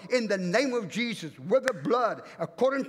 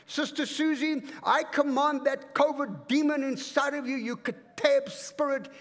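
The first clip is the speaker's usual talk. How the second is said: commanding prayer; 4 semitones higher than usual. Shown as a demonstration. Preacher rebuking covid demon over Sister Susie, forceful command. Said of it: "we don't pray like that".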